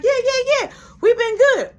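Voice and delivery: very high, loud, eager yeses; hammed-up chirpy agreement; eager reassurance. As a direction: gush that all is fine. Eager answer to the boss.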